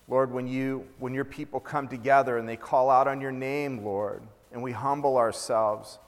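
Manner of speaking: earnest, soft-spoken prayer to God; opening prayer. Acted. Pastor praying over his congregation, earnest prayer. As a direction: pray it low and humble, interceding for others. Pastor prays before the message.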